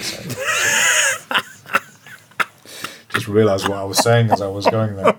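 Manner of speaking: laughing through the words. Real, not acted. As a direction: giggle at your own slip. Laughs at his own slip.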